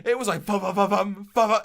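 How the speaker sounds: loud vocal beats, bum bum bum; beatbox the missing soundtrack; mock music noise. Imitating game music for his friends, loud beats. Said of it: "I'll add some music in post".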